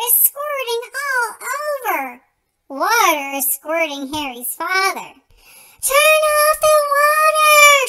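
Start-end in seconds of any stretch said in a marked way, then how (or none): 5.8-7.9 loud, high, drawn-out shout. Acted. Father soaked by the hose, shouting at Harry. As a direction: yell it slowly across the yard. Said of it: "shouts Harry's father".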